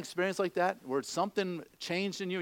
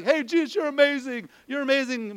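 fawning crowd; 7 semitones higher, 4 dB louder than usual. Retold or imitated. Crowd fawning over Jesus.

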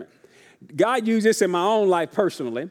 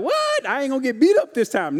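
mock surprise; 5 semitones higher than usual. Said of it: "You Apostle Paul, like".

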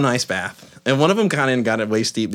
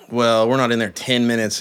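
usual speaking voice

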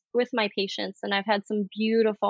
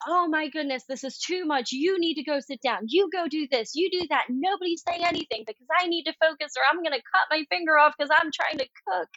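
higher, frantic mom voice; frazzled mom; 6 semitones higher, faster than usual. Retold or imitated. Overwhelmed mom voiced in kitchen.

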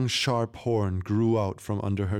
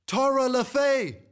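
very high, drawn-out call; long, high summoning cry; loud calling shout; 4 dB louder than usual. A narrator's reading. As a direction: call the name out with full voice. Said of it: "shouted out her grandmother's name as loud as she could".

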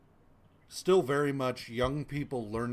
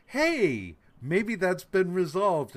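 higher, slower mock-hopeful voice; bright mock hope, pitched up and drawn out; hopeful brain; 7 semitones higher, 6 dB louder, slower than usual. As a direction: play the naive optimist in your head. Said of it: "my brain is immediately just like".

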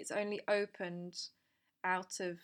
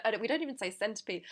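ordinary delivery

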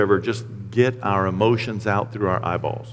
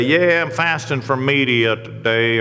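lightly mimicked self-satisfied faster, drawn out; a hint of parody, slow; mock boasting; 3 semitones higher, slower than usual. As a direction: stretch it out as a mild send-up. Mocks people's token fasting boasts.

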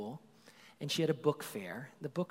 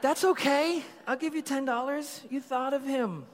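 much higher, loud, beaming; joyful reassurance; 12 semitones higher, 7 dB louder than usual. Retold or imitated. Dad happily agreeing to pay his daughter, high and loud. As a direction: gladly brush off the cost.

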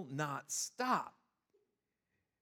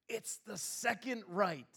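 slow, drawn-out "second right"; husband slowly repeats the wife's words; 6 semitones higher, slower than usual. Retold or imitated. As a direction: spell it out slowly, pointedly.

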